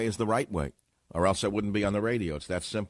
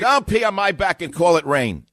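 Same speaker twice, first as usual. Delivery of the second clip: emphatic, louder repeat of "Don't pee on my back and call it rain"; 6 semitones higher, 8 dB louder than usual. Real, not acted. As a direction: hammer the saying home.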